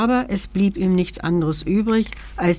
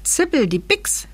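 ordinary delivery, nothing marked

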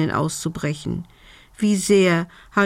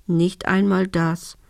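usual speaking voice

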